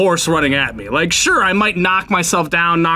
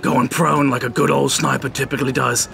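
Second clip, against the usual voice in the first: usual speaking voice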